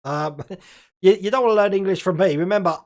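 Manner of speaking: ordinary talk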